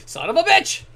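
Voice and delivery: sudden high, loud curse; real frustrated yell at the screen; angry outburst. Real, not acted. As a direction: blurt it out in frustration. Sudden outburst while gaming.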